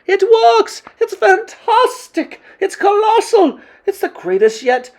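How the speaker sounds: very high, ecstatic acclaim; yelled cheering. A narrator's reading. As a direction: cheer like a crowd of thrilled witches. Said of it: "yelled the audience".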